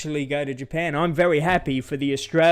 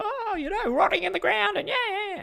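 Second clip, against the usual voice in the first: much higher put-on jeering yob voice; mocking bogan jeer. Narrator mimicking drunk Aussies jeering at grieving kids, high mocking voice. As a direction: sneer it like a drunk lout. Bogans abusing graveyard children.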